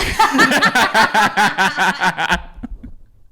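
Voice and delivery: loud, high-pitched cackle; high cackling laugh. Real, not acted. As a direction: burst out in a shrill laughing fit. Laughing at own accent bit.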